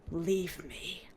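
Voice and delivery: low, quiet, pained prince voice; weak prince. Retold or imitated. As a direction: mutter it like a wounded man. Wounded prince tells her leave.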